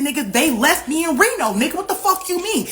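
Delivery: high-pitched, incredulous fury; retold angry outburst. Caller telling friend she was left in Reno, retold angry outburst. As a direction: climb in pitch as you go off. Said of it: "I'm going the fuck off".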